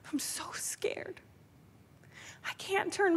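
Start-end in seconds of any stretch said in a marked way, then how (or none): 0.1-1.2 slow, breathless frightened whisper; scared whisper. Retold or imitated. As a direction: whisper it as if frozen with fear. Reliving fear before driving.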